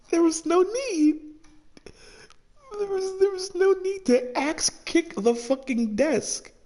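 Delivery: can't believe what he just did; incredulous. Real, not acted. Streamer reacting to a character's desk kick, incredulous and high.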